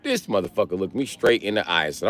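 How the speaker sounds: normal talking voice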